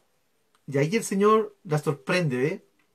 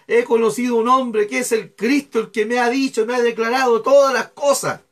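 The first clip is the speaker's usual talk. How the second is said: a hearty exclaimed cry; retold shout; 6 semitones higher, 7 dB louder than usual. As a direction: call it out as the woman running. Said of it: "empieza a gritar". (grito contado, exclamado con entusiasmo)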